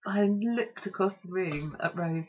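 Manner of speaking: usual speaking voice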